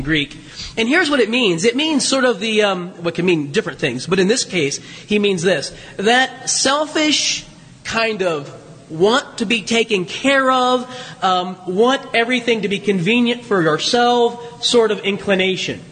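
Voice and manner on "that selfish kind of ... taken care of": ordinary talk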